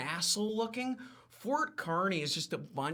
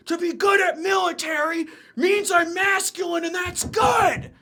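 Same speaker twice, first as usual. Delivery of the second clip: much higher, louder hammed-up ranting general; Cornwallis frantic shouting; 12 semitones higher, 8 dB louder than usual. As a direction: rant like a vain general desperate to prove himself. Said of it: "And Cornwallis was like".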